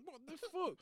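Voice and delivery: ordinary talk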